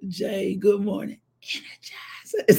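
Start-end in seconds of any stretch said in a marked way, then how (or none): 1.4-2.6 drawn-out whispered slogan; playful whisper. Pretend. Getting viewers to say the lipstick name, playful whisper. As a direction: whisper it slowly like a teaser.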